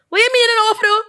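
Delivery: high, loud disbelief; incredulous outburst. Real, not acted. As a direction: demand it in total disbelief. Outraged reaction to a woman's story.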